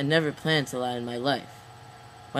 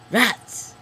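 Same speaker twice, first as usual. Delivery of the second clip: short, higher-pitched cry of annoyance; a sudden higher-pitched "Rats!" in frustration; frustrated exclamation; 6 semitones higher than usual. Acted. Narrator misses his high score, frustrated exclamation.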